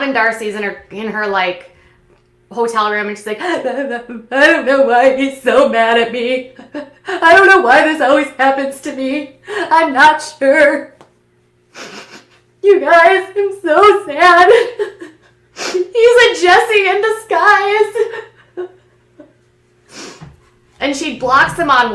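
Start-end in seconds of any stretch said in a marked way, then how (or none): 3.4-14.5 high, louder hammed-up sob voice, slowed; mock crying. Mocking crybaby Darcy's hotel-room lament, hammed-up wail. 14.5-15.1 high laughing fake sob; mock sob-laugh. Mocking Darcy's tears, laughing fake sob. 15.6-18.7 high, slow fake-crying wail through laughter; mock wailing. Mocking Darcy's complaint about Tom, fake sobbing.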